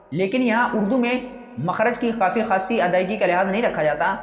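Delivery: ordinary talk, nothing marked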